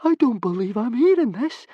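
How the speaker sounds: high anxious voice; aghast Fishlegs. Acted. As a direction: disbelief from a nervous friend. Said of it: "he said".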